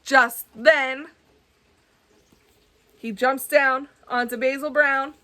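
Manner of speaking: ordinary delivery, nothing marked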